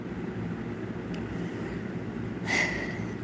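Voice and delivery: long breathy sigh out; heavy sigh. Real, not acted. Streamer sighing about her life. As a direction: let out a weary sigh.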